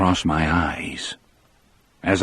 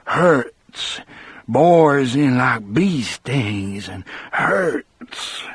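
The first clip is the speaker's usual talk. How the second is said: a lightly voiced scared giant, drawing out the last words; 2 semitones higher, slower than usual. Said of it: "he looked and sounded scared".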